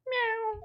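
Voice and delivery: tiny high cat meow. Acted. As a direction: mew like a small cat. Cat appears.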